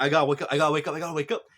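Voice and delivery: acted panic, high and loud at first; panicking. Koichi panics, trying to wake himself, frantic. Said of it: "he starts panicking".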